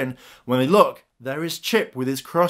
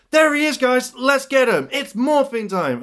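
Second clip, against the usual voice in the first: high, loud hero battle cry; rally the team to charge, pitched up high; rallying call; 11 semitones higher, 3 dB louder than usual. Acted. Gia leading Rangers at the quarry, rallying.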